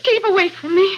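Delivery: high and sharp, in alarm; a cornered woman warns off the man blocking the door; frightened cry. Acted. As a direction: push him back with your voice.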